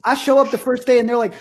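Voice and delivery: ordinary delivery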